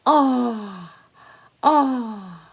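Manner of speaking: drawn-out "Oh, Oh" as a long vowel; vowel sound oh. Shown as a demonstration. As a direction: say the O sound slowly twice. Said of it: "I'm going to call you".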